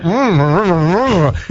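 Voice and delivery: muffled mock mumbles; mumbling with mouth full. Diner interrupted mid-bite by the waiter, mumbling. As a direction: answer in hums through an imagined mouthful. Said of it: "And you're like".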